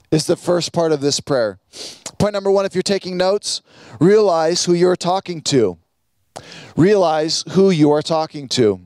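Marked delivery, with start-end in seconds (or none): none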